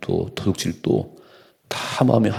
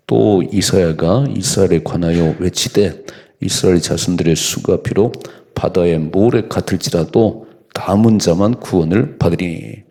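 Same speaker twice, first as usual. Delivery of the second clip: louder, measured pulpit reading; scripture reading; 5 dB louder than usual. Acted. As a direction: proclaim the verse to the congregation. Reads scripture aloud.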